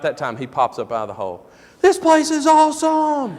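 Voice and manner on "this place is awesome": very high, loud mock child's holler; thrilled kid. Braden popping up out of the hole, thrilled shout. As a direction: imitate an elated boy shouting.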